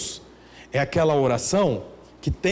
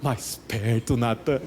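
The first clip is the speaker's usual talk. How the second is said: mock prayer voice to a friend; 6 semitones lower than usual. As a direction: pray at your buddy, mocking it. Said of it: "fala assim".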